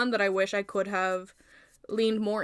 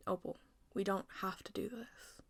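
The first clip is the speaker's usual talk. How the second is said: soft unvoiced whisper; hushed plea; 11 dB quieter than usual. Snow pleads quietly.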